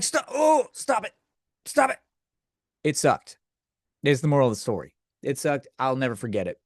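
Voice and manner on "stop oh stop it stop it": squeaky high yelp of stop it; panicked high protest at the porn actress; high-pitched alarmed protest. Retold or imitated. Telling the screen off in a high squeaky panic. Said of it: "I was like".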